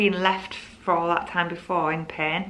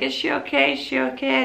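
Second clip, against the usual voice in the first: anxious asking; 7 semitones higher than usual. Retold or imitated. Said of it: "I was like".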